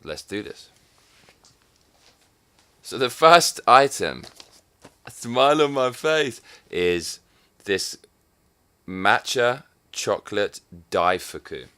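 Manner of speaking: ordinary talk, nothing marked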